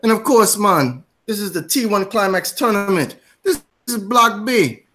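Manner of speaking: high, hammed-up Jamaican show host; bad Jamaican accent. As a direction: welcome them in a badly faked Jamaican accent. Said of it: "apologize in advance for [name]'s shitty accent".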